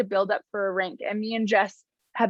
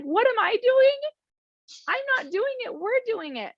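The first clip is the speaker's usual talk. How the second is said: much higher, animated and emphatic answer; excited retort; 13 semitones higher than usual. Retold or imitated. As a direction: exclaim it, then settle on "we're doing it". Her own answer to the doubters.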